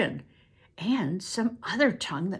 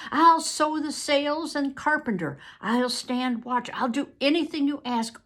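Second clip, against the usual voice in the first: lightly voiced pleading captive; pleading Pole; 5 semitones higher, 4 dB louder than usual. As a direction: beg them to take you along. Pole's pleading voice.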